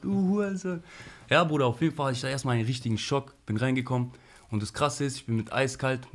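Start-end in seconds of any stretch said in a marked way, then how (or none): 0.0-0.8 high, drawn-out yell without full volume; mock shout, pitched well up; imitated yelling. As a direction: mimic a prisoner yelling abuse. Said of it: "die schreien die Bullen an".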